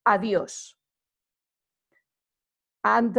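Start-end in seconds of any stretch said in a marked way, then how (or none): 0.0-0.7 adios with the final o stressed. Shown as a demonstration. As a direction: lean on the o of adios. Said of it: "an emphasis placed on the O".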